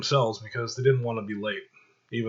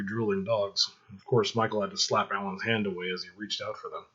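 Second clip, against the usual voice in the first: ordinary delivery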